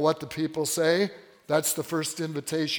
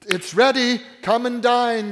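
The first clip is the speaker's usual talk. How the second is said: higher, louder call to come; calling out invitation; 5 semitones higher, 4 dB louder than usual. Acted. Servant to the invited guests, loud inviting call. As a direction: beckon the guests in.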